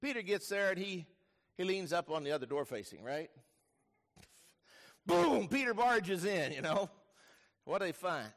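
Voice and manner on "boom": explosive, high "boom"; loud boom. Acted. Preacher imitating Peter bursting into the tomb, loud boom. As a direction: punch out a loud crashing boom.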